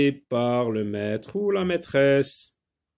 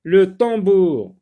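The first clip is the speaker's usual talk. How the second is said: loud, slow, higher announced word; dictation style; 4 semitones higher, 7 dB louder, slower than usual. Shown as a demonstration. Teacher announcing a new word to pupils, loud dictation style. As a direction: call out the new word, drawn out.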